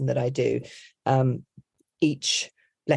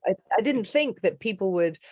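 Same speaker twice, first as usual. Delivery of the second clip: ordinary talk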